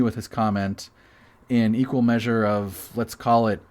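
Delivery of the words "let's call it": ordinary talk, nothing marked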